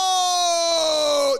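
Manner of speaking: long, loud, high mocking "oh"; drawn-out mock-impressed crowing; jeering bullies. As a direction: hoot like a gang of bullies. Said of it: "And then they're like".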